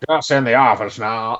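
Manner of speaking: mock villain faking an American accent; badly faked American accent, at everyday volume; put-on American accent. Podcaster imitating the German villain posing as local, fake American accent. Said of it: "a German villain doing an American accent".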